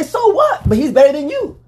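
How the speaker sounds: high, laughing mock dismissal; imitating Skip. Skip brushing off Shannon, mock scoff. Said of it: "Skip say".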